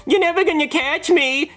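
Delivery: put-on high, teasing kid's yell; taunting kid. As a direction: taunt like a runaway brat. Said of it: "he yells".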